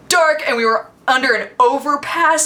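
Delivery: ordinary delivery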